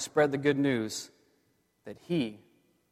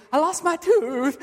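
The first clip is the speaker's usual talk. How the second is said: put-on high, slow, excited child voice breaking into a laugh-cry; a father mimicking his son who ran in after losing his tooth, laughing and crying; son's excited tooth story; 12 semitones higher, 4 dB louder than usual. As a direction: play the boy half laughing, half crying over his tooth. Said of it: "He was going crying, and then laughing".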